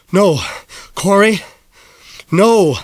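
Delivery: calling after him while running out of breath; winded calling out. Acted. Mayor chasing, losing steam.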